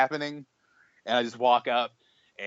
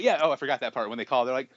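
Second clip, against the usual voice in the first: ordinary delivery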